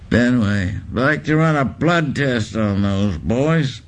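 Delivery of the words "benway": ordinary delivery, nothing marked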